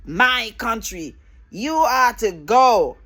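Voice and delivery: slow, weighty stress on "My country", each word drawn out; imitated emphasis. Mocking those who order immigrants out, with slow emphasis on "My" and "go". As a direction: mimic their emphasis, stretching "My" and landing hard on "go". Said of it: "They said with such an emphasis".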